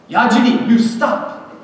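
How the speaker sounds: high, loud order to stop; commanding shout. Retold or imitated. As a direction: yell at the bully to stop. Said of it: "I heard a voice".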